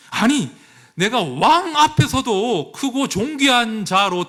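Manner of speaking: a general outraged that he was not even greeted; indignant outburst. Retold or imitated. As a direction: let the insulted pride boil over. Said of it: "화가 난 거예요".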